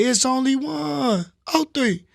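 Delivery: high, drawn-out rap ad-lib; signature ad-lib. Shown as a demonstration. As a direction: stretch it out high like a track tag. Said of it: "used to have an ad lib that say".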